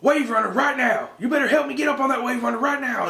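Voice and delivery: dad yelling at his son to pull him up, high mock shout; bossy dad shout. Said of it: "what really made me laugh is he's like".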